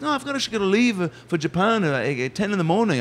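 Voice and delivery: high, hesitant star impression; high mimicked celebrity hedging. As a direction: demur in a high put-on voice. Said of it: "Paul would turn to look at me".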